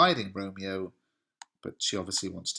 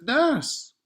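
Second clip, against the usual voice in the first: high, loud acted cry; calling out; 6 dB louder than usual. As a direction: exclaim it sharply, pitch leaping up. Romeo cries out to nurse.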